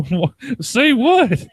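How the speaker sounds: put-on high, loud cantankerous old woman; old granny. Granny challenging her granddaughter, loud old-woman voice. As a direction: squawk it as a baffled old granny. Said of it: "this old, like, old-ass woman".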